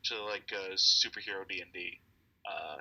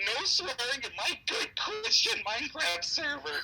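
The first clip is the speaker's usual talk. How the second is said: very high, slow, prissy scold; prim meme voice. Retold or imitated. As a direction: put on a fussy church-lady squeak.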